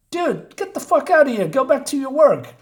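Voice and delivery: a fed-up yell at the character; exasperated outburst. Real, not acted. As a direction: shoo her away in exasperation. Yelling at the character.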